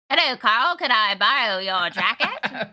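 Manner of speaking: put-on old Hollywood mid-Atlantic accent. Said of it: "I gave him a mid-Atlantic accent".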